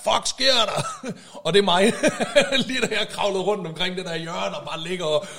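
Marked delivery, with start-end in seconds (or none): 0.0-0.8 high, loud mock yell breaking into laughter; retold yell. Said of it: "så hører han en råbe". 1.1-4.6 talking through real laughter, pitched higher; laughing. Real, not acted. Laughs at his own quoted yell.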